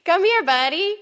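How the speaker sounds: high, cooing voice for a pet. Real, not acted. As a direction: call the dog sweetly and high. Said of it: "you just heard my dog baby voice".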